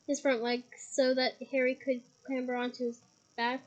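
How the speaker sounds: plain reading voice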